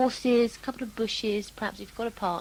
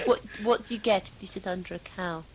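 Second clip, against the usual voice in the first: normal talking voice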